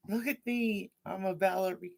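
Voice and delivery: high-pitched mimicry of a prancing classmate; mock ballerina cry. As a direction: imitate a kid showing off, pitched well up. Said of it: "dancing, screaming".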